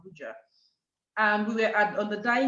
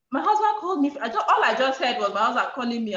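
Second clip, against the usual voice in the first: ordinary talk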